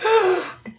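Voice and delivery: sharp high gasp of delight. Real, not acted. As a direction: gasp as if spotting treats. Spots biscuits in parcel.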